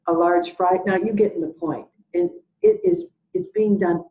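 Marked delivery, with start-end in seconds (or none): 0.1-0.8 "A large fry" echoed loudly as a question; repeating as a question. Retold or imitated. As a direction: parrot "A large fry" back in a loud, mock-doubting query. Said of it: "repeats what you say".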